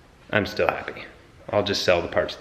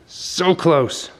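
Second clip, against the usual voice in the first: excited; 9 semitones higher, 5 dB louder than usual. Real, not acted. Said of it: "is getting me so excited".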